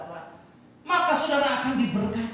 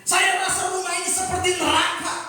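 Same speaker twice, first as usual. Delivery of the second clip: loud, high-pitched despairing complaint; acted desperate outburst; 7 semitones higher, 5 dB louder than usual. Preacher acts out an unhappy wife pouring out troubles, desperate outburst. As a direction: act out a wife at breaking point.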